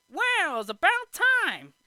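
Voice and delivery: high, loud mock indignation; hammed-up exasperated cry; Jiminy Cricket. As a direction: exclaim it as an impatient little cricket. Jiminy finally gets in, acted.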